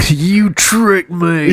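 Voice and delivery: high, drawn-out fake outrage; hammed-up betrayed cry; mock accusation. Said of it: "they're like".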